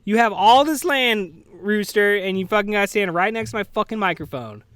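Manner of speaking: irritated rant pitched well above normal talk; real exasperated scolding. Real, not acted. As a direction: tell off a noisy animal, loud and fed up. Scolding a rooster by the mic.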